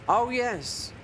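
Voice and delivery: high-pitched retold "oh yes"; retold recognition. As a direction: act out sudden recognition, pitched well up. Retells a guest recognising the photo.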